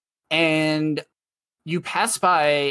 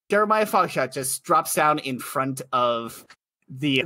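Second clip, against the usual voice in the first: ordinary talk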